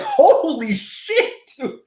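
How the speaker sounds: high, slow, stretched-out holy shit; stunned exclamation. Retold or imitated. Recalling her teepee story, a high stunned drawl. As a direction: drawl it in amazed disbelief. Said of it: "I was like".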